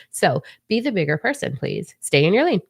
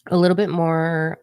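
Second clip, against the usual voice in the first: ordinary talk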